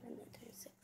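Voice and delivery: barely audible whispered count. Real, not acted. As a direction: whisper the last number. Whispering the timer.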